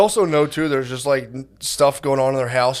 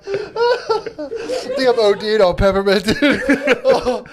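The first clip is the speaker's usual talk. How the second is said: laughing; 10 semitones higher, much slower than usual. Real, not acted. Laughing at own joke.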